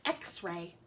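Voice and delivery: ordinary talk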